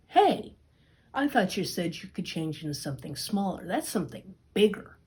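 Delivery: yelp, then complain quickly; startled cat. Acted. Puss in Boots startled by the lion, sharp yelp then fast complaint.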